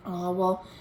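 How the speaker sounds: plain reading voice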